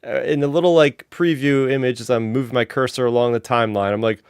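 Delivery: usual speaking voice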